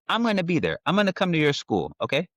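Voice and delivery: put-on high-pitched promise to a child; mock up a dad sweetly promising his little girl; reassuring dad. Dad's hypothetical promise to daughter.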